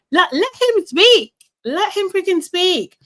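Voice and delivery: high-pitched exasperated plea; exasperated outburst. Acted. Commentator vents at interrupting partner.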